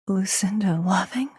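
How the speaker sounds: hushed, whispered question; stunned whisper. Acted. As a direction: whisper the name in disbelief. Character stunned by a new name.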